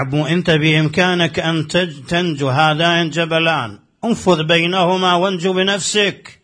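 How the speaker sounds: رفع الصوت بالمشهد؛ خطيب يرفع صوته بحماس. Acted. ذروة خطبة الحسين لنافع.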